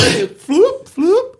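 high floop sound. Acted. Imitated sound.

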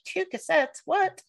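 high, startled disbelief; surprised. Retold or imitated. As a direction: react as if shocked by the box. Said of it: "I was like".

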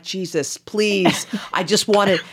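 high, loud pleading exasperation; exasperated. Retold or imitated. Weary passenger pestered by seatmate, exasperated.

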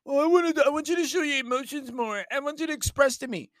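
high, pleading demand; mock demanding girlfriend. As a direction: ask like a needy partner. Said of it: "girlfriend demands emotions".

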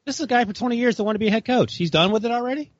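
high-pitched disbelief; incredulous. Real, not acted. Co-host challenging the idea that Zimmer would step away, incredulous. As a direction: question it in astonished high voice. Disbelieving reply to retirement talk.

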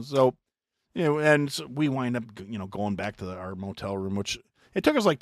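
ordinary delivery

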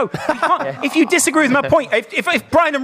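high, laughing complaint; laughing protest. Real, not acted. Teasing a friend who shushed him, laughing.

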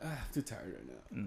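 low, soft, worn-out mock excuse; Joe. Teasing a friend about dodging phone calls, mock-tired. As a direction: sigh it out quietly, imitating someone too tired to talk. Imitating Joe begging off a call.

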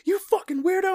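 very high-pitched imitated insult; mock outrage. Angry classmate to the panicking boy, in mock outrage. Acts out angry bully before punch.

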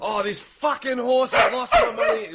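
high, hammed-up wail over a lost bet; overacted Australian punter. Punter hamming up his loss at the races, overacted wail. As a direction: melodramatic loser crying about the horse. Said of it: "That's how good that overacting was".

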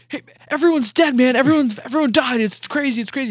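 high, loud frantic retelling; hammed-up alarm, breaking bad news; mock panic. Acting out Jake's fake panic.

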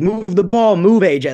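urgent yell, pitched well up; imitated coach's yell. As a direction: mimic a coach yelling at players. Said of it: "he was yelling at the guys".

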